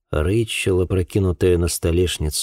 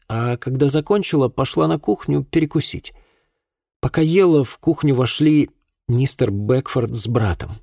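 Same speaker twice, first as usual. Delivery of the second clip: put-on higher-pitched voice for a girl recounting events; distressed girl witness; 8 semitones higher than usual. A narrator's reading. Girl recounts that night to an investigator, tense. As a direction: tell it as a troubled young woman, pitched up.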